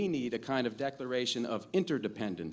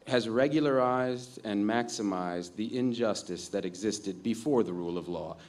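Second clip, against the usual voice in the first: usual speaking voice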